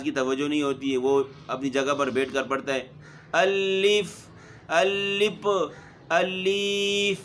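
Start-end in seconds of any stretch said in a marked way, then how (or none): 3.3-4.3 الف said clearly as alif; الف with a long open a, slow and exact; correct alif. Shown as a demonstration. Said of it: "الپ نہیں پڑھانا". 4.7-5.5 الپ with a p sound at the end, shown as a mistake; wrong alip. Shown as a demonstration. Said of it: "الپ نہیں پڑھانا". 6.1-7.2 الیف stretched very slowly with a long ee; drawn-out alif. Shown as a demonstration. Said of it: "الیپ نہیں پڑھانا".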